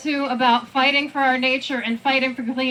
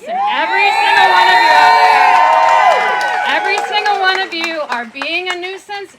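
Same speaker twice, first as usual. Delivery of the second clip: loud and higher, rallying every listener; rousing crowd shout; 6 semitones higher, 13 dB louder than usual. Real, not acted. Director rallies protesters at a park rally, loud rousing shout.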